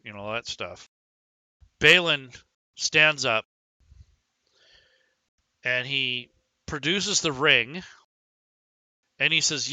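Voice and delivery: ordinary delivery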